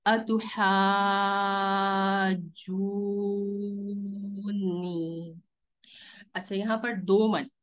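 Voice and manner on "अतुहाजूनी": अतुहाजूनी chanted very slowly, long vowels held; Quranic recitation. Shown as a demonstration. Teacher recites the example word for students, very drawn out. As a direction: draw out the madd in a slow recitation chant. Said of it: "इसको पढ़ेंगे".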